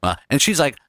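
usual speaking voice

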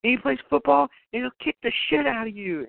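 high, fast, frightened threats to the caller; a hammed-up scared young woman bluffing; panicky girl voice. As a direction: squeak out the boyfriend threat in a rush. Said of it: "she's like".

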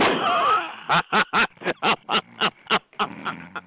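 drawn-out run of chuckles; long chuckling laugh. Real, not acted. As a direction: keep laughing in repeated bursts. Laughing at own remark.

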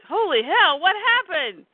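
loud, high-pitched alarmed exclamation; shocked outburst. Acted. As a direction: blurt it out in acted shock. Shocked Pete at crime scene.

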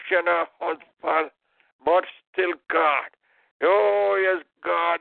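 ordinary talk

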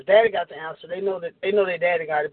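ordinary talk

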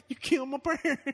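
high laughing twang; [name] voice. Pretend. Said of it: "[name]".

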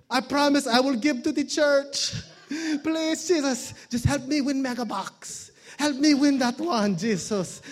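put-on high, begging Filipino-accented prayer; pleading Filipino gambler. Filipino gambler praying to win Mega Bucks, pleading in accent. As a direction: beg God to win the jackpot, in a Filipino accent. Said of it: "I do the accent".

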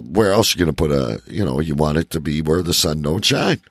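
ordinary talk, nothing marked

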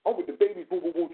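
ordinary delivery

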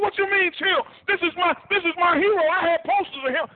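can't contain his hero worship; starstruck fan. Retold or imitated. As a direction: teammate protesting to the speaker, high and excited. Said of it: "He lost his proverbial mind".

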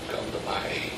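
plea whispered on breath alone. Acted. Echoing Hezekiah's cry for help, whispered. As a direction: breathe the prayer desperately.

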